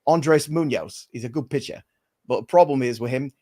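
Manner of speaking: Cockney British impression; Michael Caine. Host reads baseball injuries as a Cockney actor, Michael Caine impression. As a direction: deliver it as a Cockney film star. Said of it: "It's almost, it's almost Michael Caine".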